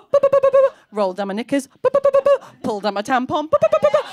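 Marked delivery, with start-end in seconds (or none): 0.0-0.7 high, loud, rapid boops on one note; imitated crossing beeps. Comic beeps out the crossing signal as lights change, imitated beeping. 1.7-2.6 high, loud, rapid boops on one note; imitated crossing beeps. Comic beeps out the crossing signal as lights change, imitated beeping. 3.5-4.0 high, loud, rapid boops on one note; imitated crossing beeps. Comic beeps out the crossing signal as lights change, imitated beeping.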